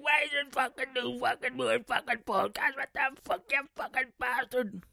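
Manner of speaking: high, put-on complaining listener voice; mocking a listener. Mocking listeners who moan about new podcasts, whiny. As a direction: whine it like an annoyed fan. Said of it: "for any be like".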